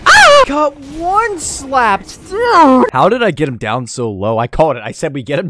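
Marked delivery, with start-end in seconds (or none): 0.1-0.4 loud, very high scream; sudden high-pitched yelp; startled shriek. Real, not acted. Hit by boss. 0.5-2.9 high, very drawn-out complaint; slow, high-pitched wail; whining protest. Real, not acted. Killed by one hit.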